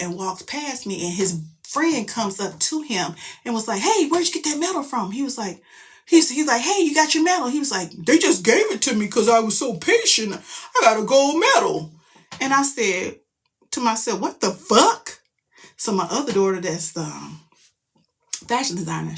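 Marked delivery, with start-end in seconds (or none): 3.8-5.1 much higher, curious voice; high-pitched retold question; friend's excited question. 6.6-7.5 higher, louder greeting; loud mock-friendly question; friend's excited question. Voices the man's friend. 7.9-11.9 put-on voice, louder and gleeful; loud brag, slowing on the prize; boasting older man. 14.2-15.2 slow, louder, higher disbelief; drawn-out mock outrage; retold indignation.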